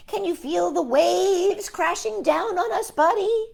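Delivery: high, slow shout over the waves; hammed-up storm shout. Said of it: "shouted Earl".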